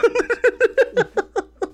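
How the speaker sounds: long high cackle; high laugh. Real, not acted. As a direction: keep laughing hard at the bit. Laughing at own joke.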